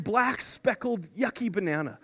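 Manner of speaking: ordinary talk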